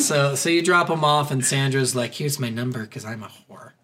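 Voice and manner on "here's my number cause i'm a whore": much softer, lower mock throwaway; mock offhand line. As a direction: toss it off under your breath. Voicing Sandra dismissively.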